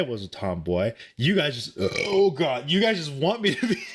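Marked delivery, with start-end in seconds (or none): 1.2-3.8 flustered, laughing, faster protest. Real, not acted. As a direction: laugh through the denial at chat. Protesting chat, breaking into laughter.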